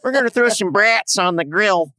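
broad, playful Minnesota accent. Shown as a demonstration. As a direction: play a Minnesotan at a cookout. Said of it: "some good Minnesota accent right there".

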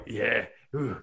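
ordinary talk, nothing marked

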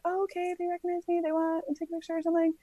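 high, soft, quick aside to yourself; pleased inner voice. Retold or imitated. Athlete thinks she is recognized, high soft mock inner voice. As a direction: think it aloud, small and high.

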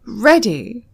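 called out loudly; loud announcement. Acted. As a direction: snap it out to make him jump. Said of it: "she said loudly".

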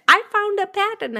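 high, loud mock announcement; mimicked triumphant discovery. Mimics friend spotting a pattern.

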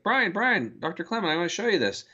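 mock excited call for attention; eager calling out. Professor calling Doctor Clement over to show him something, eager.

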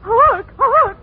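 loud prompting shout. Acted. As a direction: bellow the cue again. Prompter cues louder.

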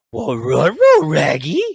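lightly voiced cartoon dog; light Scooby-Doo voice; Scooby-Doo impression. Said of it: "I did the Rutro Raggy".

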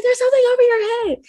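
high, loud, frightened insisting; scared warning. Retold or imitated. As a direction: shout up in alarm at mom. Scared kid warning mom.

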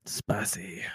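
breathy whisper under the breath. Real, not acted. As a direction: murmur it to yourself, almost silent. Quiet aside on card combo.

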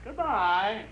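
a chilling polite goodbye; sinister high farewell. Acted.

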